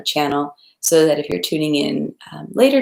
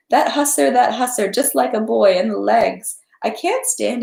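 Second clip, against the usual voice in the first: high, excited exclamations of a delighted hostess; amused old lady crying out in delight; 8 semitones higher, 3 dB louder than usual. Acted. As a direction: gush over the costumed guests, pitched up. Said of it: "the voices said".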